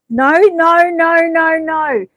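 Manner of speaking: high, loud run of no's; firm loud refusal. Real, not acted. Streamer to viewer Angela, loud insistent no's. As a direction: cut off the excuse, loud and high.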